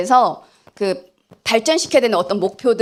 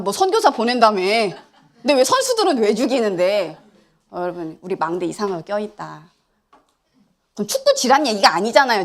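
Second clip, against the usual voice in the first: ordinary talk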